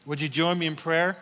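ordinary talk, nothing marked

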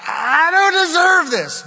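high, loud whining complaint; mock the self-pitying inner voice; whiny self-pity.

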